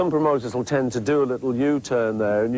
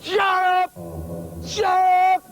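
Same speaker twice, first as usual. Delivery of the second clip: high, distant shout thrown across the room; squeaky far-off shout from a pretend heckler; much slower than usual. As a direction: throw your voice so the yell seems to come from elsewhere. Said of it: "That was just me throwing my voice".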